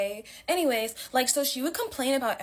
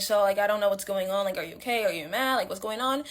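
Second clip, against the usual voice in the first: ordinary talk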